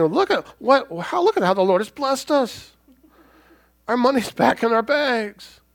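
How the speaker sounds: high, bright, slowing delight at God's blessing; hammed-up grateful brothers; mock joyful praise. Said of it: "they're not saying".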